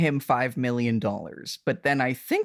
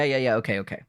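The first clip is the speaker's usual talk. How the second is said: ordinary talk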